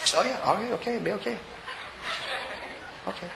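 soft, halting mumble to himself; hushed, uneasy acting. Uneasy hotel manager watching the praying, hushed and hesitant. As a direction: imitate someone uneasily backing off, very softly. Nervous manager backing away, acted.

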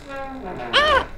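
sharp, high, very loud yelp of fright; startled scream. Acted. Boy startled by a noise in the house, high scream. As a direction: jump out of your skin.

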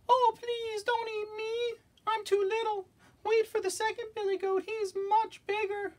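pleading tiny falsetto goat; very small voice. Acted. Smallest goat begging the troll, tiny pleading voice. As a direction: beg very high, drawing out the words. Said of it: "in his very small voice".

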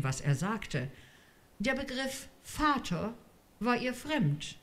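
ordinary delivery

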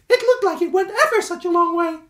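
high, loud squeaky badger voice; helpful Stuart. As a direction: chirp it up brightly and helpfully.

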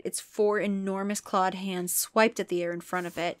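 ordinary talk

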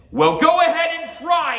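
high, full-voiced shout; defiant hero. A narrator's reading. Little samurai daring the ogre, shouted defiance. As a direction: hurl it back as a dare.

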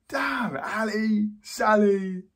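loud, high, slowly stretched names; drawn-out exclamation. Acted. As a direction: stretch each name out loudly. Exclaims over names Ali and Sally.

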